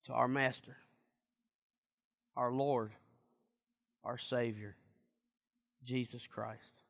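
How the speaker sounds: soft, falling, slow list of titles; hushed reverence. Acted. Preacher naming the Lord to the congregation, hushed. Fading after climax.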